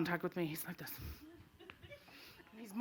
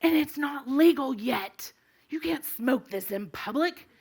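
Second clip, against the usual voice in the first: slow, deliberate mock policeman; stern officer; 4 semitones higher, slower than usual. Officer lectures woman on public smoking, slow and stern. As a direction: spell it out slowly, scolding. Officer scolds her, acted.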